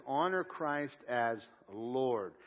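very slow, every word weighted; very slow emphatic. Acted. Preacher to congregation, driving home the key verse slowly.

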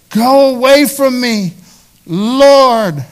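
loud pleading cry. Acted. Peter at Jesus' knees, crying out loud. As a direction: Peter begging Jesus to leave him, loud and high.

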